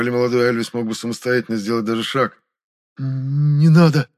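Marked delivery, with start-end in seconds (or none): 3.0-4.1 long-drawn, high whimpering plea; slow, piteous begging; plaintive. A narrator's reading. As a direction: stretch the words out pitifully. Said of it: "жалобно пробормотал Темноликий".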